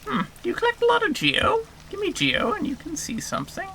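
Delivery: high, airy, half-whispered creature; game creature voice. Acted. Game creature wheedling a visitor for geo, high whispery creature voice. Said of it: "doing that voice".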